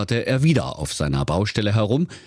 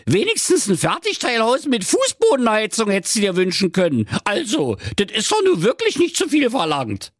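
shrill-pitched Berlin nag, complaining hard; nagging wife. Acted. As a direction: rant like a fed-up fairy-tale wife.

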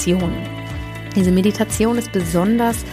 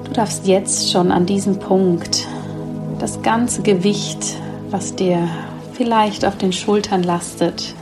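sleep meditation. Acted. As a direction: guide them gently into sleep.

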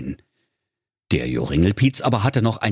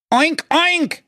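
high pig squeal. Acted. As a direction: answer with a desperate squeal. (sehr hohes, lautes Quieken)

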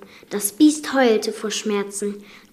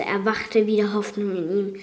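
plain reading voice

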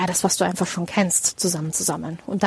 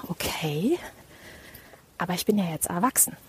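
quiet self-talk; 8 dB quieter than usual. Retold or imitated. Calming self-talk quote.

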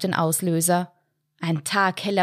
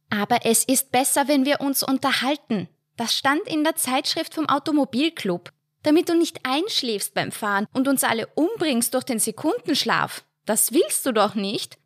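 nagging wife; 7 semitones higher than usual. A narrator's reading. Wife voiced in story.

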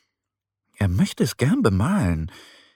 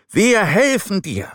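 higher voice, a touch louder; animals promising; 9 semitones higher, 4 dB louder than usual. A narrator's reading. Forest animals promising the bunny help, eager higher voice. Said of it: "versprechen die Tiere".

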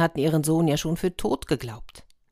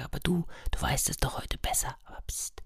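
whispered aside; 9 dB quieter than usual. A narrator's reading. Narrator's secret aside to child.

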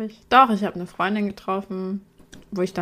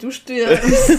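laughing; 3 semitones higher, 7 dB louder than usual. Real, not acted. Joking about deadly candle.

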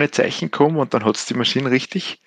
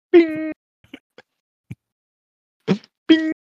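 chime imitation. Cabin chime before announcement.

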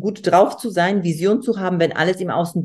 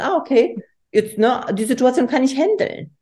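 calm coach inner voice; 6 semitones higher than usual. Retold or imitated. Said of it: "sondern du weißt".

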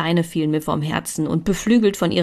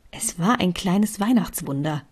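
plain reading voice

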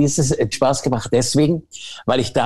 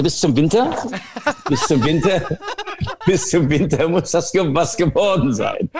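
laughing; 4 semitones higher than usual. Real, not acted. Joking deadline, laughing through it.

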